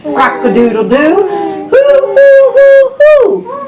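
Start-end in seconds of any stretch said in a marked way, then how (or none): 1.7-3.6 high, loud owl hoots; owl hooting. Pretend. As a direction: hoot like an owl. Owl sound in picture book.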